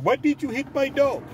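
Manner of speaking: high, exaggerated Mexican-accented voice; put-on Mexican accent. Owner confronting driver, hammed-up Mexican accent. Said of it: "didn't come out talking like that".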